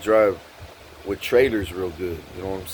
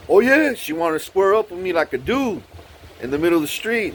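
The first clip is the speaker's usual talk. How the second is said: higher, cocky retold taunt; squaring-up challenge; 7 semitones higher than usual. Woman squaring up in the street, challenging. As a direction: puff it up like someone starting a fight.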